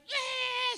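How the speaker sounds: high-pitched mock wail; exaggerated child's cry, very high; imitated crying. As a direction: burst out bawling like a little girl. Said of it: "She starts crying".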